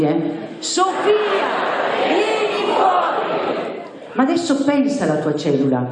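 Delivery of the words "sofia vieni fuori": high, drawn-out shouted summons; shouted group call. Shown as a demonstration. Preacher has the congregation repeat, shouted call. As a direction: cry it out so the deaf hear. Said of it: "Ripetiamo un'altra volta che siamo un po' sordi".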